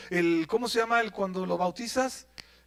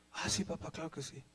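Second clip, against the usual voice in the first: put-on whispering boy; 7 semitones lower, 16 dB quieter than usual. Isaac obediently answers father.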